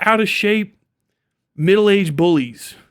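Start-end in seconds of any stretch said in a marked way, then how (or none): none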